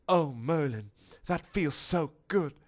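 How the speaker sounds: high, breathy-sounding swoon of pleasure; hammed-up blissful castle. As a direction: melt into it up high.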